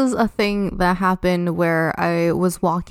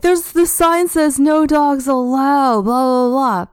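higher, louder mimicked yell, dismissive at the end; mock screaming; 5 semitones higher, 4 dB louder than usual. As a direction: parrot her shouting from the fence.

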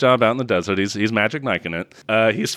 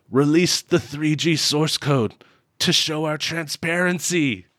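hammed-up dying proclamation; mock desperate declaration; 7 semitones higher than usual. Acts out desert phone plea.

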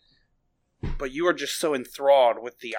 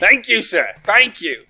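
gleeful thanks; 5 semitones higher, 9 dB louder than usual. Real, not acted. Player thanking creatures.

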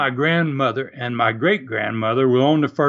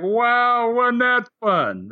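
mock delight; 7 semitones higher, much slower than usual. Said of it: "It was like".

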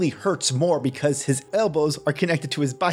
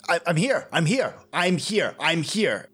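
urgent, breathless, pitched up; desperate calling out; 6 semitones higher than usual. Acted. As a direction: plead to be noticed in time. Racing the countdown to the scanner.

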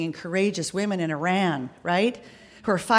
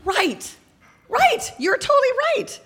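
squeaky, higher, faster; high-pitched agreement. Acted. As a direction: jump way up in pitch. Mock agreement with assistant.

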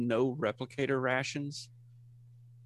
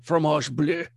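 pompous French waiter; comic French accent; 5 dB louder than usual. Acted. Waiter explaining what Paris calls it, French accent.

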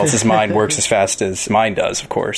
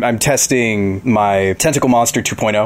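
normal talking voice

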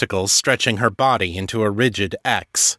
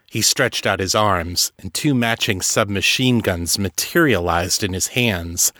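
plain reading voice

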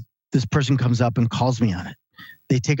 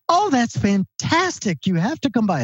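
put-on much higher little-old-lady voice, delighted; grandmother on the phone; 10 semitones higher than usual. Grandson calls his hundred-year-old grandmother, much higher old-lady voice. Grandmother invites them over.

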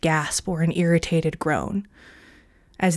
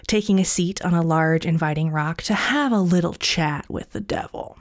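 usual speaking voice

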